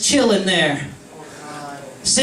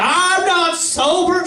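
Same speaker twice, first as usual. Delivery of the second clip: put-on high, slow drunk denial; drunk protest; 10 semitones higher, slower than usual. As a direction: drag it out, obviously wasted. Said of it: "And I'm like".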